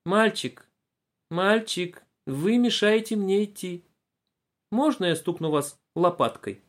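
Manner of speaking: выше, медленно, кисло-вежливо; тянет слова, как воспитанный ребёнок; кислый вежливый ребёнок. A narrator's reading. Разговаривали примерно так.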